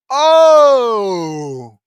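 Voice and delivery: long loud "oh" sliding down; loud falling wail of disbelief; long loud groan. Real, not acted. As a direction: cry out one drawn-out "oh" at full volume. Outburst at the story's twist.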